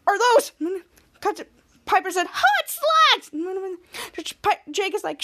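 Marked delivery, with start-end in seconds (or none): 0.1-0.5 high, loud acted disbelief; mock freak-out. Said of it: "who was freaking out". 2.3-3.3 high-pitched, loud mock thrill; acted excited exclamation. Acts out an excited reveal.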